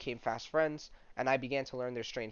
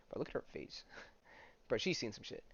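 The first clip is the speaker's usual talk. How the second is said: hushed, half laughing aside; giggly hushed aside; whispered joke; 10 dB quieter than usual. Real, not acted. Joking about drawn face.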